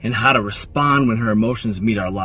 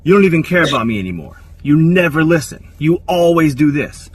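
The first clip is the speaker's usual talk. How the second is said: a touch of a hurt partner's complaint, louder; upset accusation; 5 semitones higher, 6 dB louder than usual. Retold or imitated. Woman accusing her man of not listening, mildly upset. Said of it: "She'll say something like this".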